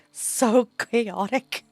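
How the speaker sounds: "so chaotic" loud and drawn out; emphatic. Real, not acted. Laughing at absurd idea.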